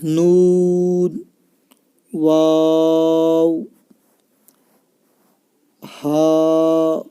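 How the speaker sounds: each Arabic letter name drawn out slowly and clearly, with a pause after each; slow letter recitation. Shown as a demonstration. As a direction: recite the alphabet slowly for a child to copy. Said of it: "ध्यान दें". (हर हर्फ़ धीरे और साफ़ बोलें)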